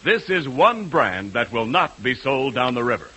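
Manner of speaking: ordinary talk, nothing marked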